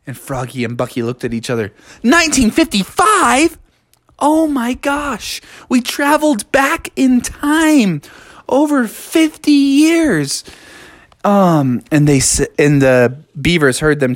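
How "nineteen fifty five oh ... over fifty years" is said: high, slow astonishment; stunned amazement. A narrator's reading. Froggy and Bucky realize the year, stunned amazement. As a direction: let it sink in slowly, high-pitched. Friends learn they time-traveled.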